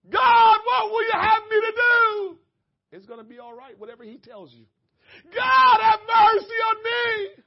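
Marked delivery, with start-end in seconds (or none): 0.1-2.4 high, loud cry to God; shouted plea. Shown as a demonstration. Preacher crying out to God before the church, loud. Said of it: "repeats the responses shouting". 5.3-7.4 high, loud, slow cry; shouted mercy plea. Shown as a demonstration. Preacher pleading with God in front of the church, loud and slow. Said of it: "repeats responses shouting".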